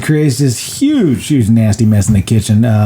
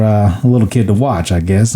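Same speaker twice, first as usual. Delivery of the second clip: normal talking voice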